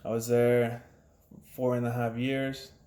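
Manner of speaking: usual speaking voice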